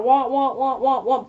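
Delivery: laughing womp womp like the muffled adult voice; mock the ex's words as meaningless droning; Charlie Brown womp. Mocking the ex's talk as Charlie Brown noise, laughing. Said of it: "is Charlie Brown".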